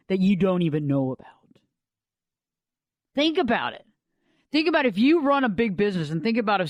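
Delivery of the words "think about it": usual speaking voice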